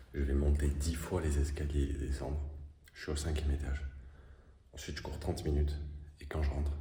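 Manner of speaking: ordinary talk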